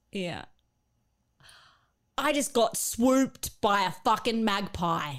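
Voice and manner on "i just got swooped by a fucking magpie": slow, indignant Bogan Australian; an angry-sounding go at a broad Bogan accent. Shown as a demonstration. Trying out a classic Bogan sentence for the co-hosts, Bogan accent. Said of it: "sounds like an angry [name]".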